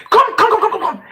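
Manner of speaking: high and loud, fading at the end; mock stern caller. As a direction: call the kid over like a strict teacher, then ease off. Voicing punisher calling child over.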